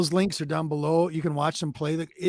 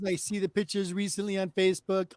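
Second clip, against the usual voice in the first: ordinary delivery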